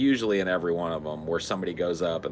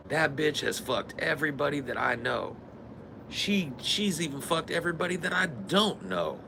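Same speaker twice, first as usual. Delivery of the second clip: mock mean guest; 7 semitones higher, 5 dB quieter than usual. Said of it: "It's always like".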